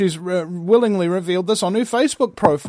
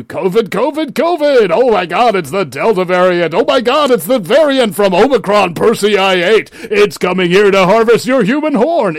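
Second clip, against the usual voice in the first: loud, higher, hammed-up hysteria; mock panic; 3 semitones higher, 5 dB louder than usual. Media scaring viewers about variants, mock panic. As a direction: wail it as an over-the-top alarm.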